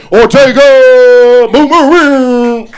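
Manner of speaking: long, high, booming shout; announcer bellow. Pretend. Host hyping the comic to the crowd, drawn-out shout. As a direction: stretch each name out at full volume.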